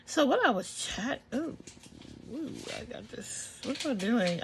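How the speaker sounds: talking while chewing gum. Real, not acted. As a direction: speak around a wad of gum. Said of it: "I can't talk and chew bubble gum".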